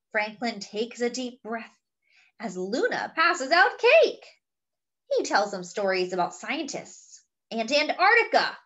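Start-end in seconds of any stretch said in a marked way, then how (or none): none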